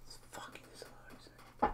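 hushed, fast dread; anxious whisper. Real, not acted. Fan fearing the goal is ruled out, a fast whisper. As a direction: barely breathe the words.

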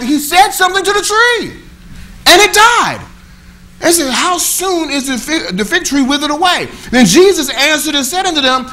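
high and loud, driving preaching; preaching at full force. Real, not acted. Preacher to congregation, preaching at full force. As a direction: lift the whole room with it.

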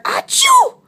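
loud, high mock sneeze; acted sneeze. A narrator's reading. Loris allergic to cats, big acted sneeze. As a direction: burst out with a fake sneeze.

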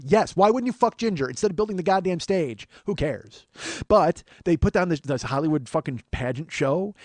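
ordinary talk, nothing marked

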